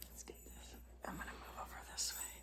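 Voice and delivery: quick hushed whispered aside. Real, not acted. Parent reading aloud, whispering a quick aside. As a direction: whisper it so as not to break the story.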